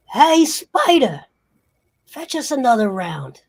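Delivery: loud, high tough-guy order; bullying crime boss. Acted. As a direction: holler a drink order to a lackey. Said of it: "and then he says".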